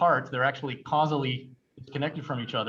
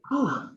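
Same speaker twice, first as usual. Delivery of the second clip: high exhaled whew, catching breath; breathless whew; 10 semitones higher than usual. Real, not acted. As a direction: blow out a winded breath. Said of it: "It's hard to talk and breathe in this thing".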